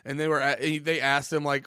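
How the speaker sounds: ordinary delivery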